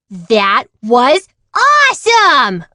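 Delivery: thrilled cheer. Acted. Kids cheer after crash.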